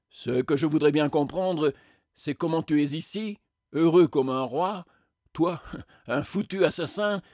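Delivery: resentful spectre, scornful of the other's luck; aggrieved spectre. Acted. Spectre begrudges the murderer his happiness, aggrieved. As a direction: protest the injustice, settling into bitter complaint.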